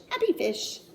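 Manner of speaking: ordinary delivery, nothing marked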